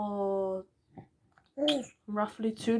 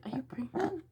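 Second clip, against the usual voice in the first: imitated whisper; 6 semitones higher, 5 dB quieter than usual. Partner's mom asking over phone.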